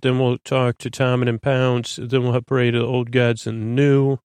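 normal talking voice

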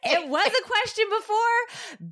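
high, laughing through the words. Real, not acted. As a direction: crack up mid-sentence. Laughing at the spat.

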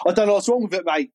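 mock blokey chat to a mechanic. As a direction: pretend you know how to build a car. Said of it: "speaking to a mechanic voice".

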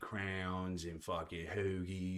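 slow Delco twang on each word; Delco accent. Shown as a demonstration. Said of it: "I lost my twang".

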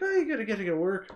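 high, loud put-on Irish; Irish accent. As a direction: chat to the wife in a mock Irish accent. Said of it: "speaking with an Irish accent".

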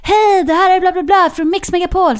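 high, loud, far-off radio host voice. Pretend. Teens pranking classmates on the phone as a radio DJ, high and loud. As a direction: sell it like a hyped DJ.

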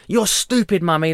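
put-on high toddler tell-off; imitating toddler. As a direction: play your son scolding mummy. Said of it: "He's like".

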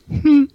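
high little "hmm"; mimicking a friend's quirky high hum; imitated punchline hmm. Friend copying Clint's tic after a joke, high hmm. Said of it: "then he goes".

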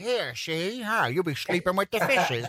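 mock old New York gangster talk. Host mocking co-host's idea of New Yorkers, hammed-up New York gangster accent. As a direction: do a cartoon New York mobster, ending in babble. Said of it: "people are walking around going".

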